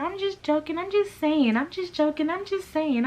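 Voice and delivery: high, whiny mock of her excuse; mocking imitation. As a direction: parrot her like a silly, squeaky backpedaler.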